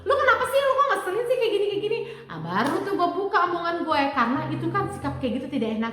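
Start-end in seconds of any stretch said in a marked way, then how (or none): 0.1-2.0 much higher, irritated "kenapa sih"; husband finally fed up with wife, higher and sharper; annoyed husband. Retold or imitated. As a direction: snap back, fed up. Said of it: "akhirnya jengkel kan dia".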